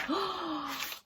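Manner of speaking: quick high gasp of delight; a real gasp of delight; sharp gasp. Real, not acted. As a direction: gasp in at something lovely. Delighted at a book page.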